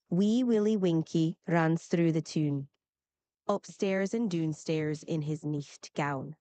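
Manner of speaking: nursery rhyme recited in Scots; Scottish accent. Shown as a demonstration. Assistant reciting Wee Willie Winkie on request, Scottish accent. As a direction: recite it like a Scot. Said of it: "like a Scottish nursery rhyme".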